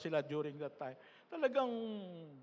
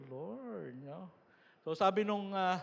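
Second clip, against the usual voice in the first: ordinary talk, nothing marked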